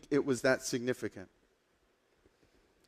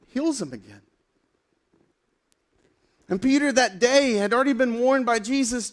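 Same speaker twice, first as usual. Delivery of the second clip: ordinary talk, nothing marked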